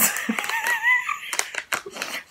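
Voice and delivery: very high, breathy squeal of a laugh; surprised squeaky laugh. Real, not acted. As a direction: burst into a shrill giggle. After tasting soap-like candy.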